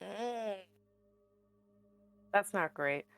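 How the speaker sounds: ordinary talk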